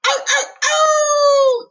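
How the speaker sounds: slow shrill falsetto ow; high falsetto yelps. Acted. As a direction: squeal it out long. Outro yelps.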